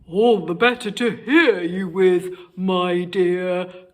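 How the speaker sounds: wolf answering Little Red, crackling. Acted. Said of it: "crackled the wolf".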